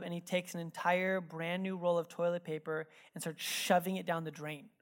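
normal talking voice

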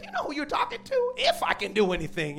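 high, incredulous sass; a touch of mock indignation; sassy Jesus. Jesus talking back to the pleading father, sassy and incredulous. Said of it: "He's like".

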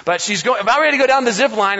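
normal talking voice

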